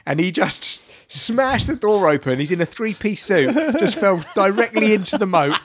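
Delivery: ordinary talk, nothing marked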